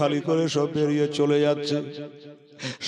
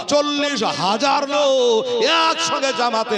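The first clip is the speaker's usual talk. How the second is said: preaching shout; 10 semitones higher than usual. Real, not acted. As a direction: proclaim it to the whole crowd. Preacher stirring the crowd.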